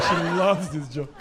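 normal talking voice

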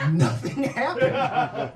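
usual speaking voice

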